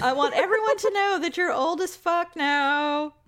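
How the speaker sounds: high, put-on gleeful proclamation; mock proclamation. Imagining him announcing her age, high mock proclamation. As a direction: proclaim it high, half laughing through the joke.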